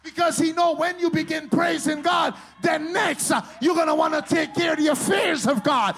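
loud, high shouted preaching; preaching at full force. Real, not acted. Preacher shouts about the devil to congregation. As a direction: drive it home at full volume.

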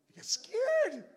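normal talking voice

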